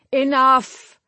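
high, loud one-word yell; loud, commanding cry. A narrator's reading. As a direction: yell to halt a fight. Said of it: "Salamander yelled".

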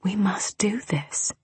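slow, soft whisper; soothing. A narrator's reading. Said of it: "I soothed".

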